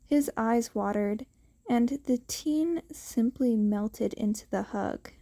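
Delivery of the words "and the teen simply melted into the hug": usual speaking voice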